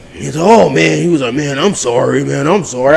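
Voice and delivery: ordinary talk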